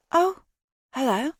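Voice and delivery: a sudden high startled oh; startled teenage girl. A narrator's reading. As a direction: caught off guard, pitch jumping high. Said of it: "looking startled".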